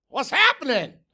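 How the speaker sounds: loud, high blare; blaring. A narrator's reading. Tacky blaring at his teammates, loud and high. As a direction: blare it out like a big bird. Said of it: "he blared".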